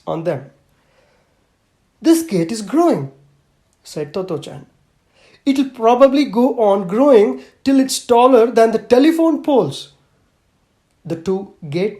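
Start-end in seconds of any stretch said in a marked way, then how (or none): 2.0-9.8 put-on higher and louder child voice, marveling; wondering little girl. Little girl telling Mother about the growing gate, amazed. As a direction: delight in the living gate like a small child.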